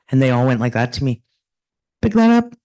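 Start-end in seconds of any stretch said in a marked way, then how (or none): 2.0-2.5 high, louder insistent urge; mock insistent elder; urging call. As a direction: push the gift on her with a raised voice. Elders urging her, gesturing.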